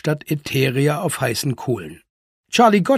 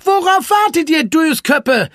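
high, impatient cry; agitated urging; 13 semitones higher, 5 dB louder than usual. A narrator's reading. Franka hurries her dawdling friends, agitated and high. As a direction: scold them in a high, agitated voice. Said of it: "zitterte sie".

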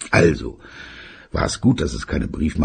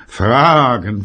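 grumpy father; 7 semitones higher, 6 dB louder than usual. Acted. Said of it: "sagte sein Vater".

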